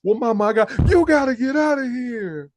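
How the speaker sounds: high, loud shouted eviction; mock angry mama. Retelling the mother kicking her son out, high yell. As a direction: throw him out in a high yell. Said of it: "She's like".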